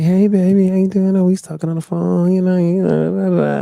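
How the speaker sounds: mock her gushing over the phone, voiced high; imitating the woman. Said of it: "And she's like".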